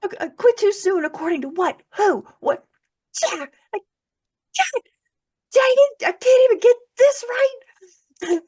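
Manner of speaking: very high and loud, cracking into a cry; furious and near tears. Retold or imitated. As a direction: shout at heaven until the voice breaks. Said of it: "I was mad".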